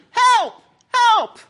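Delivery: high, loud cries for help; short high-pitched shouts; yelling for help. Acted. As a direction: yell it out, desperate to be heard. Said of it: "Jack keeps yelling".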